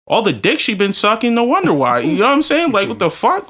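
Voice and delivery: normal talking voice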